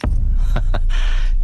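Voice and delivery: short, voiceless laugh; a taunting laugh ahead of a threat; menacing laugh. Acted. Laughs before threatening the policeman. (धमकी से पहले की हँसी)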